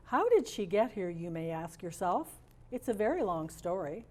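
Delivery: ordinary delivery, nothing marked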